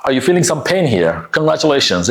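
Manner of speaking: ordinary delivery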